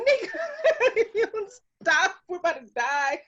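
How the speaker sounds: high, loosening into laughter; laughing plea. Retold or imitated. Friend begging Nika to stop while running for their lives, high laughing plea. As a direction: plead through helpless giggles.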